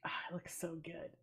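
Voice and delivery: fast awed whisper. Real, not acted. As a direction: breathe it out in awe. Admiring new background.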